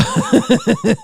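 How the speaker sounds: short high laugh; laughter. Real, not acted. Host laughs while trying to read donations, high giggle. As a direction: break into a quick high giggle.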